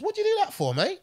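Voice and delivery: high, put-on Aussie hardman voice, baffled; Chopper impression. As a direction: imitate a stabbed tough guy, surprisingly unbothered. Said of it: "And then Chopper goes".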